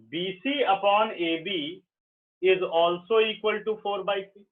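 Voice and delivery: slow, word by word dictation. Shown as a demonstration. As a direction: dictate it slowly to the class. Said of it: "ऐसे लिखो".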